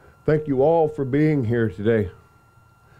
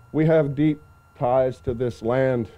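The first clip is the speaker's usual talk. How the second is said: ordinary talk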